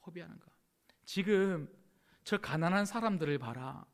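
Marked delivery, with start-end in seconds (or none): 0.1-0.5 soft echo. Acted. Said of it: "어찌하여 이 향유를 허비하는가?".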